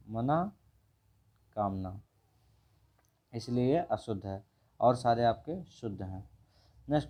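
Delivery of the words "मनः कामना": मनः कामना with a long pause after manah; word broken up. Shown as a demonstration. Teacher dictates the correction to students, word broken up. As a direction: say मनः, wait, then a lower कामना. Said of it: "मनः कामना होता है".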